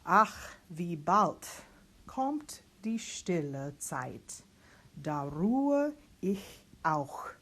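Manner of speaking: Ach loud, then the line stretched out slowly; teacher models the phrase for singers; slow German diction reading. Shown as a demonstration. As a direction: read the German line slowly, savouring each sound. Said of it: "and then the A U diphthong".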